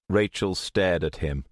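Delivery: ordinary delivery, nothing marked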